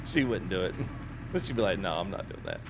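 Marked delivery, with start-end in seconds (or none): 1.8-2.6 low, quiet mimicked refusal; imitated refusal. Streamer imitates wife turning down gaming, low quiet refusal. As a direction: lightly mimic wife's deadpan no.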